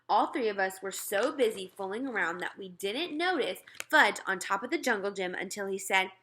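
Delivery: ordinary delivery, nothing marked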